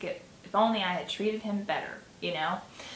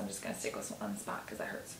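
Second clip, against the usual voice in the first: whispered aside; 11 semitones lower, 12 dB quieter, faster than usual. Real, not acted. Aside about bump, hushed.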